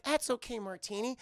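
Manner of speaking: gentle comforting. Retold or imitated. Olive comforts the crying penguin, soft and gentle. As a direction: soothe someone who is upset.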